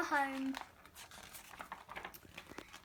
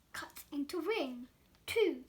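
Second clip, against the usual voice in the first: plain reading voice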